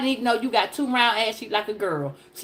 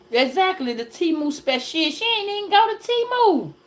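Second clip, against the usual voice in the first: usual speaking voice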